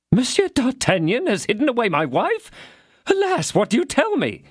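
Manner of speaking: high, aghast lament; dismayed. Acted. Husband learns his lodger hid his wife, aghast. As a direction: cry out in dismay. Husband hears shocking news.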